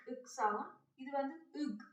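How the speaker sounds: normal talking voice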